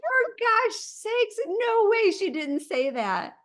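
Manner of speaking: slow, high, stunned disbelief; shocked disbelief. Real, not acted. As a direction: gasp it out in disbelief. Shocked at listener's rude reply.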